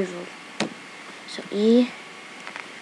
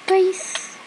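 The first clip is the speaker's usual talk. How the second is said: normal talking voice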